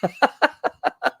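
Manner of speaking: a bright real laugh, well up in pitch; high delighted laugh. Real, not acted. Author laughs recalling the founder knew his book, high laugh.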